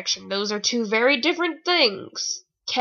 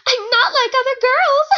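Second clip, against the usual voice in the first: squeaky, sneering mock-girly voice, higher and louder; 12 semitones higher, 4 dB louder than usual. Reader mocking the heroine's cliché line in a squeaky sneer. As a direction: parody the cliché in a prissy high pitch.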